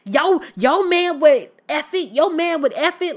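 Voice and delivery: mock-confrontational, pushed up high and loud; mock fighting taunt. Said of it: "Evelyn was about to fight people".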